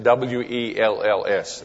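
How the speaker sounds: W E L L S spelled letter by letter, slowly. Shown as a demonstration. Preacher clarifying past his accent, W E L L S spelled out. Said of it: "did you get that out of my accent".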